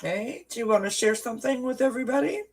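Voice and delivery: high, fast coaxing for a pet. Real, not acted. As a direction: coax the kitty sweetly. Coaxing the cat to speak.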